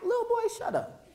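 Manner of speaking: mock dismissive. Imagined dismissive adult.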